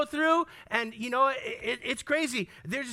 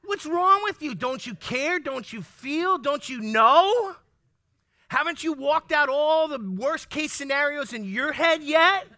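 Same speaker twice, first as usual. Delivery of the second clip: loud, higher exasperated demands; exasperated outburst; 4 semitones higher, 5 dB louder than usual. Retold or imitated. Spouse ranting at calm partner, loud exasperated mock outburst. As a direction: demand why they don't care, hammed-up.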